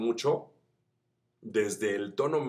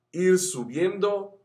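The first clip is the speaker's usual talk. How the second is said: slow, higher climb on the words; rising pitch; 7 semitones higher, 3 dB louder, much slower than usual. Shown as a demonstration. Vocal coach showing students the voice climbing, slow and higher. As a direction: stretch it upward slowly. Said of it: "la voz va a ir subiendo".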